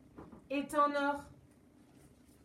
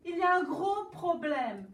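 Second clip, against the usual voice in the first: loud, higher, distressed explaining; king pours out his trouble, louder; upset king; 5 semitones higher, 6 dB louder than usual. Acted. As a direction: blurt out the problem in distress. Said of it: "Le roi dit".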